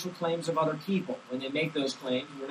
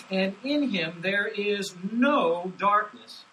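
slow, higher, ringing read; emphatic scripture reading; 4 semitones higher, 5 dB louder, slower than usual. Shown as a demonstration. Pastor reading John's message aloud, slow and emphatic. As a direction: proclaim each word slowly. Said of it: "Notice how emphatic he is".

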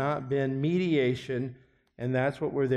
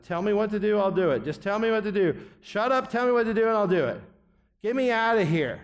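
fed-up agreeing addict; 6 semitones higher, 3 dB louder than usual. Retold or imitated. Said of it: "they just agree to end the intervention".

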